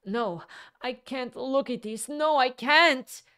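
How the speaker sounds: high, loud anguished cry. A narrator's reading. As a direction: cry it out, close to tears. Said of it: "cried Anna Richardovna".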